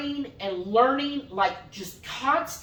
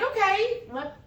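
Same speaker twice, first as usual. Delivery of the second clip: much higher startled okay; mock surprise; 10 semitones higher than usual. Wife imitating husband's surprised reaction, much higher. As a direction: act caught off guard.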